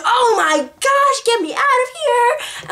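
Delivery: high, slow, wailing half-cry; panicked plea. Retold or imitated. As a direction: beg to escape the dream, near tears. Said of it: "like, crying".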